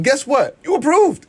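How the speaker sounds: high, bright good-news delivery; excited announcement. Retold or imitated. Retelling upbeat pitch to customer.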